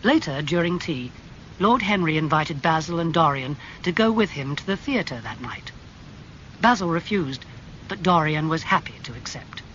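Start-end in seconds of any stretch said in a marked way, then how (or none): none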